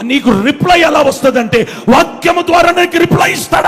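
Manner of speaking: high, loud climactic answer; full-force preaching. Real, not acted. Preacher explaining God's reply to the congregation, shouted preaching. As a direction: drive the answer home at full voice.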